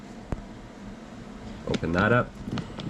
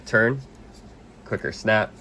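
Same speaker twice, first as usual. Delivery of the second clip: usual speaking voice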